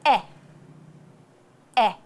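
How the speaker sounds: "eh" said short and wide; short E sound. Shown as a demonstration. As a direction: widen the mouth for a brief "eh". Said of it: "widen your mouth and just say".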